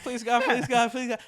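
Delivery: high, loud rapid begging, acting out panic; mock-panicked plea to God; retold frantic pleading. Retold frightened begging under the cat.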